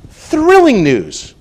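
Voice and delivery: high, slow and loud on thrilling; Thrilling drawn out high and loud; thrilling proclamation. Shown as a demonstration. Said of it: "Shout from the housetop".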